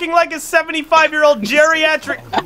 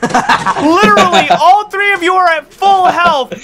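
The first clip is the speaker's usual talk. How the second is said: raised, high-pitched, stretched-out complaint; exasperated rant; 5 semitones higher, 3 dB louder, slower than usual. Real, not acted. Player scolding teammates who took health, exasperated. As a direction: rail at them, dragging out the key words.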